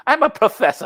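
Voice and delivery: high-pitched character impression; movie character. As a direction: mimic a goofy film character, pitched well up. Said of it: "one of those motherfuckers on to something about Mary".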